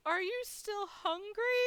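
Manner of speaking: high, slow, caring question; kindly concern. Retold or imitated. Front desk lady offering upset guest food, high caring voice. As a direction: ask tenderly, stretching it out high.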